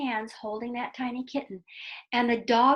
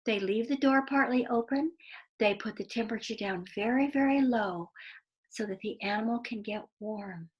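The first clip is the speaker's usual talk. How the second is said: put-on expressive read-aloud to children; lively storyteller voice for kids; story narration. A narrator's reading. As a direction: tell it like a bedtime story, drawing them in.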